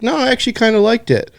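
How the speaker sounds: high, quick mock friend voice; lightly squeaky quoting of a boat friend, fast; imitating a friend. Friend on the boat defending the movie, high and fast. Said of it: "someone else is like".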